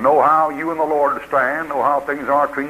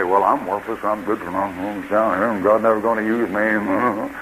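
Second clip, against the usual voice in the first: mock dejection; 4 semitones lower than usual. Discouraged believer giving up on himself, mock dejected. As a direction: sink into put-on hopelessness, dragging the end. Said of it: "don't let the devil get you down".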